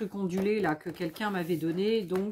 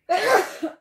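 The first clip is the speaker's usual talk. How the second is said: sudden loud sneeze; a real sharp sneeze; sneezing; 11 semitones higher, 8 dB louder than usual. Real, not acted. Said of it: "je vais éternuer".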